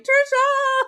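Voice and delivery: very high mock wail for help; panicked call. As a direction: cry out the name in hammed-up panic. Acts out panicked call to friend.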